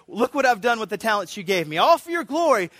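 eager to show God; enthusiastic. Retold or imitated. Believer standing before the Father, building joyful excitement. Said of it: "quite enthusiastic to say".

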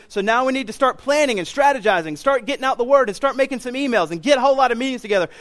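high, rushing pep talk; getting them excited. Acted. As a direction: rattle off plans with mock eagerness. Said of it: "he's getting the disciples excited".

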